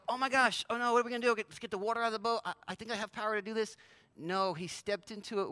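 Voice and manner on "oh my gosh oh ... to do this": mock freak-out. Preacher imitating a Jesus who panics, mock fluster. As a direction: fake flustered panic, speeding into a rapid babble. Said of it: "He didn't freak out and go".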